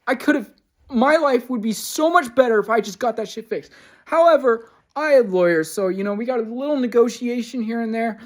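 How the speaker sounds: angry venting. Real, not acted. Venting about the wrist surgery, loud and frustrated. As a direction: keep the anger up as you explain.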